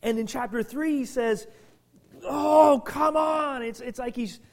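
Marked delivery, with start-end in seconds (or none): 2.2-3.6 loud, exasperated outburst; a fed-up apostle shouting at his wayward church; exasperated outcry. Retold or imitated. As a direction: throw up your hands and shout it. Paul exasperated at the Galatians.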